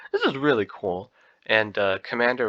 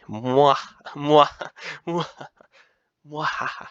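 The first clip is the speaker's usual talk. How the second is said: loud smacking air kisses; blown kisses; 5 semitones higher, 5 dB louder, much slower than usual. Acted. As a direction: blow exaggerated kisses to the camera. Blowing kisses.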